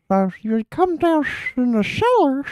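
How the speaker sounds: put-on high, wavering old-man voice; high mock old man calling; Herbert from Family Guy. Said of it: "Mister Herbert from Family Guy".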